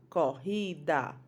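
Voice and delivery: "Corrida" read slowly, syllable by syllable joined; slow blended word. Shown as a demonstration. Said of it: "blending the whole word". ("Corrida" devagar, juntando as sílabas)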